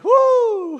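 high whooping laugh; joyful high whoop. Retold or imitated. His joyful reaction retold.

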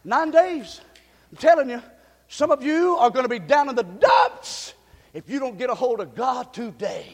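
usual speaking voice

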